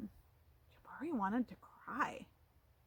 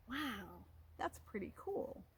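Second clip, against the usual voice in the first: ordinary delivery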